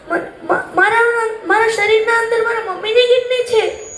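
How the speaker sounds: halting disbelief building into a pained realisation; emotional shock. Acted. Grown son learning of mother's kidney sacrifice, emotional shock.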